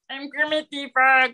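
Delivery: cartoon creature voice that misses the mark; Kermit the Frog impression. Shown as a demonstration. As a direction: do a badly off puppet-frog imitation. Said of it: "I was like".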